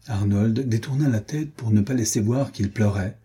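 ordinary delivery

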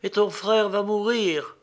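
high, mournful ghostly voice; sorrowful ghost. A narrator's reading. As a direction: lament like a grieving ghost. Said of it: "plongée dans une profonde affliction".

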